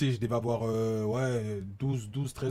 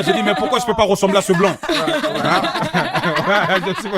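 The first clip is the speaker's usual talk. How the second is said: laughing; 5 semitones higher, 10 dB louder than usual. Retold or imitated. Retells childhood wish, laughing.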